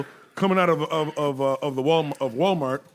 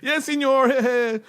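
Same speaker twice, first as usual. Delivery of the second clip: higher, hammed-up servile waiter voice; mock resort worker; 7 semitones higher than usual. Mocking the worker who serves drunk tourists, higher. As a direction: play the worker cleaning up after drunks.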